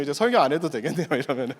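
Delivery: ordinary talk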